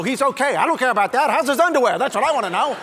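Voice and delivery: loud, high mock-urgent medic; imagined doctor. Doctor in the ER caring only about underwear, mock urgency. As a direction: demand it as if it mattered most. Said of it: "the doctor's gonna go".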